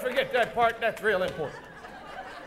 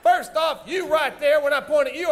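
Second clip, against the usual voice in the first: ordinary talk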